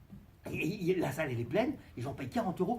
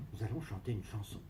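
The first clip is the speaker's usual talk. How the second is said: solemn God voice; 8 semitones lower, 10 dB quieter than usual. Acted. God's voice.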